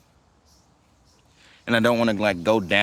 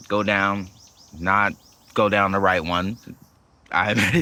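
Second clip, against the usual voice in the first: usual speaking voice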